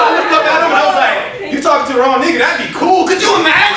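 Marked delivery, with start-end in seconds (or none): 1.5-3.8 loud, raised-pitch comic outburst; fired-up mock confrontation; indignant retort. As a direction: throw it out loud and incredulous.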